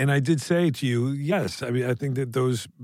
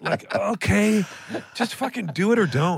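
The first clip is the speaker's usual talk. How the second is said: exasperated outburst; 8 semitones higher than usual. Real, not acted. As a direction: let the frustration spill out. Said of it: "why am I so mad about it".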